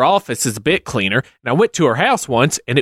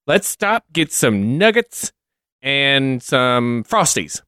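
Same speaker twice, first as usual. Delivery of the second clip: casual suggestion; slower than usual. Retold or imitated. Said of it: "And you just say".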